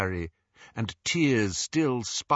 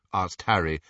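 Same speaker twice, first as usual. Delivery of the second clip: ordinary delivery, nothing marked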